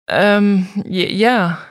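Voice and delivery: verlegen stockend, lauter ansetzend; überrumpelt herausgestammelt; stammelnd. A narrator's reading. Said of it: "stammelte Susanne". (Susanne stammelt überrascht vor dem Uniformierten)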